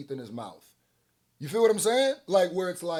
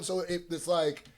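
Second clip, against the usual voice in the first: usual speaking voice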